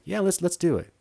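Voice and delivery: high eager voice. Retold or imitated. Wife's eager reply voiced.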